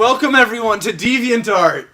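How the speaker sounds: hammed-up announcer. Hammy welcome to the video.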